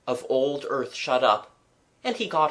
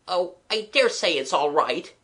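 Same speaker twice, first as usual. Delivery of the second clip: mild irritation; 5 semitones higher than usual. Acted. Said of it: "with an irritation not strong enough".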